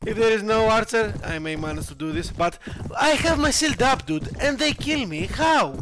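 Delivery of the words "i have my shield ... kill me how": high-pitched, exasperated complaint; indignant protest. Real, not acted. Gamer killed with his shield up, indignant. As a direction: demand an explanation in raised, incredulous pitch.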